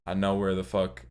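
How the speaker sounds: normal talking voice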